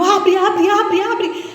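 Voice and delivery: high, loud repeated begging; frantic plea. Retold or imitated. Woman pleading with the driver, frantic. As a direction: beg desperately to get out.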